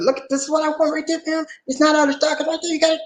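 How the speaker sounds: high-pitched playful protest; friendly teasing. Retold or imitated. Customer ribbing a clerk over the shelf, friendly teasing. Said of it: "teasing, funny way to let 'em know".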